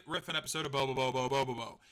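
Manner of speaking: normal talking voice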